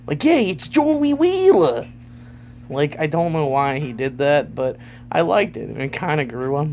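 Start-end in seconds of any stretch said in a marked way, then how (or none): 0.2-1.9 put-on high, slow Brooklyn accent greeting; Joey Wheeler Brooklyn accent. Imitating the cartoon voice actor, in Brooklyn accent. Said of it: "Brooklyn's accent".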